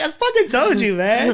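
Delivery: high, laughing gloat; laughing I-told-you-so. Retold or imitated. Student to pissed teacher after mom's call, laughing and gloating. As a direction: crow it through a laugh.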